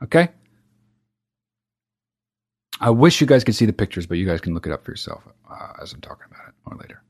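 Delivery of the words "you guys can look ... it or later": whispered aside. Real, not acted. Trailing aside to listeners.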